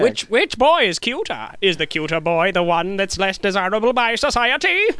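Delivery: high, eager producer questions; old-timey producer voice. Retold or imitated. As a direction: quiz like a studio boss. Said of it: "Old-timey voice".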